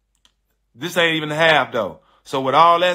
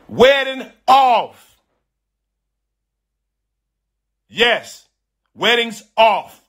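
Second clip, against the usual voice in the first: drawn-out, higher-pitched declaration; emphatic announcement; 5 semitones higher, much slower than usual. Retold or imitated. Groom declares the wedding cancelled, emphatic and drawn out. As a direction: call it off with slow, heavy finality.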